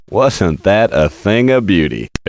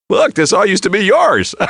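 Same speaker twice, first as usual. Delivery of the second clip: gloating; 12 semitones higher than usual. Acted. Gloating at losers.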